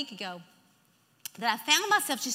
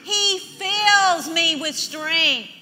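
loud, very slow call to repeat; bold declaration; 3 semitones higher, 9 dB louder, much slower than usual. Shown as a demonstration. Preacher leading the congregation to say it, loud and bold. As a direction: lead the room, stretching each word. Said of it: "Say that with me".

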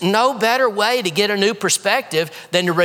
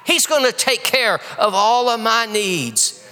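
ordinary talk, nothing marked

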